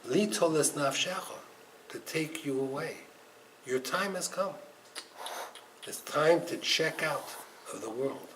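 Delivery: quiet, grave messenger of death; solemn pronouncement. Retold or imitated. Angel of death telling the groom his time has come, hushed and solemn. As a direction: announce it softly, with pauses, sinking away.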